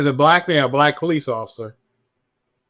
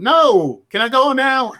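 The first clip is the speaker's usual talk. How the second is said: louder, higher retold brush-off; impatient refusal; 10 semitones higher, 4 dB louder than usual. Officer dodging questions after a shooting, impatient. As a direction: act out wanting out of there, pitched up.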